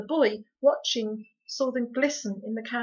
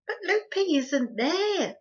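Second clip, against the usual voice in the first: distressed; 10 semitones higher, 7 dB louder, slower than usual. Acted. Homily distressed.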